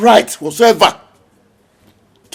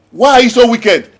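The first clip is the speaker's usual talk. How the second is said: louder, accusing; angry accusation; 4 semitones higher, 5 dB louder than usual. Acted. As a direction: hurl the question at him.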